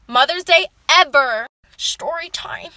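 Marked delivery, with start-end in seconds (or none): 1.8-2.7 slow, stretched half-whisper; soft, drawn-out storyteller's tease; hushed title tag. Pretend. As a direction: softly draw out the catchphrase.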